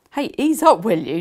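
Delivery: lightly voiced dad, loud and teasing; playful protest, a bit high; amused dad. Dad teasing daughter drying his hair, loud amused. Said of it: "He was laughing at me".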